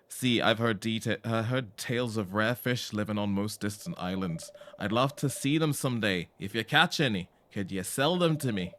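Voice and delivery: put-on loud villager in a muddled British-Isles accent; eager, hammed-up regional British-Isles accent; uncertain British-Isles accent. Tobin asks for rare fish, hammed-up British-Isles accent. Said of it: "What is this accent?".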